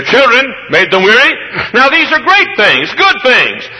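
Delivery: high ringing preacher's cry; full-force preaching. Acted. Preacher to his congregation, full-force preaching. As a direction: lift it up like a revival preacher.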